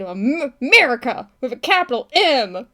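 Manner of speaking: loud, emphatic teacher slogan; history teacher's catchphrase. Retold or imitated. Imitating a history teacher's saying, loud and emphatic. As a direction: punch it out proudly like a catchphrase. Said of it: "teachers always said".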